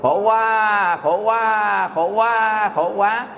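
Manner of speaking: drawn-out mock baby wail shaped into words; imitated newborn cry. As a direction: wail it in long vowels like a newborn. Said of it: "nghe tiếng khóc nghe sao?". (giả tiếng khóc oe oe của trẻ sơ sinh)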